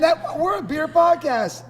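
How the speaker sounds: high laughing outcry; laughing shout. Real, not acted. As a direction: blurt it out through a laugh. Laughing outburst about the beer.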